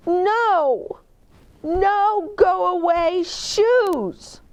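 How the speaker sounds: put-on high, slow toddler wail; whiny little-girl no; child's protest. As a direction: stomp your foot like a small child.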